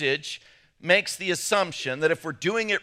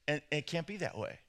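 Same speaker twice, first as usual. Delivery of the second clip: ordinary talk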